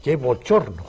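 ordinary talk, nothing marked

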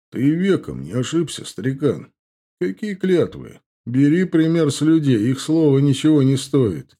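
sly, mocking orc; smug orc voice. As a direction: play the cocky young orc. Indgar mocking.

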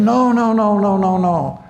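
high, rapid-repeated insistent refusal; acting out someone who won't hear of it; eager protest. Helper refusing to let the soldier take over, eager protest. Acted refusal to stop helping.

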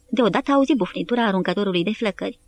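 usual speaking voice

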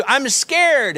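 high-pitched imitation of a frightened little girl; mock-scared child's plea, pitched well up; scared daughter. Preacher acting his daughter on the roller coaster, mock-scared. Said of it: "And then you hear".

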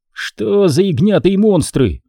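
alarmed. A narrator's reading. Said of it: "не на шутку встревожился Майкл".

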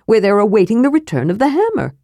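fast, eager kender voice; rushed kender. Acted. Kender rushing to convince Flint, fast eager voice. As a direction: hurry it out eagerly.

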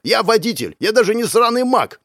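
high, agitated protest; barking back. A narrator's reading. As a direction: snap back in exasperation. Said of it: "рявкнул он".

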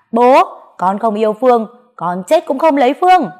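high, loud desperate scream. Acted. Daughter screams at her father she won't marry Phương. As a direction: scream it out in defiance. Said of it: "Tôi gào lên".

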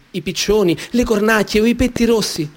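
ordinary delivery

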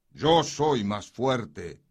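loud, slow boast; sword voice. A narrator's reading. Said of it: "volvió a decir la espada".

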